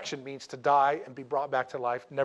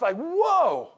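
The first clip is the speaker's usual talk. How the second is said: mock whoa; 12 semitones higher, 6 dB louder than usual. Pallbearers freaking out.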